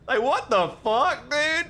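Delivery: offended gamer reacting to a mean game character; outraged disbelief. Real, not acted. Outraged at insult.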